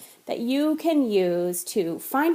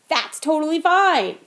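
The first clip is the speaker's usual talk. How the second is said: emphatic reassurance; 5 semitones higher, 5 dB louder than usual. Acted. Repeats reassurance twice.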